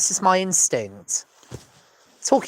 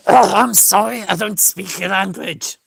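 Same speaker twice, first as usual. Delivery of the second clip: a light Dutch accent, slow; lightly put-on Dutch accent, words slowed; 3 semitones higher, 5 dB louder, slower than usual. Shown as a demonstration. Comic showing how a Dutch person would say it, light Dutch accent. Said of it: "That's how the Dutch would say it".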